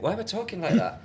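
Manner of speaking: high, loud, exasperated self-question. Retold or imitated. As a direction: ask yourself in disbelief. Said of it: "I'd listen back and I'd think".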